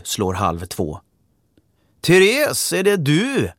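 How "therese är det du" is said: much higher, louder call from another room; man calling out. Acted. Father calls out to his daughter from a room, cheerful and loud. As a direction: call cheerfully for the girl through the house. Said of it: "ropar en mansröst".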